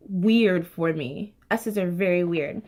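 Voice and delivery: usual speaking voice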